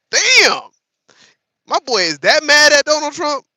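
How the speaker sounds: high, loud astonished outburst; shocked amazement. Real, not acted. Streamer amazed the politician is that angry. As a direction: marvel at how mad he is.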